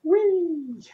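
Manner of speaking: high, loud playful wee; excited squeal. Real, not acted. Playing with ripple effect.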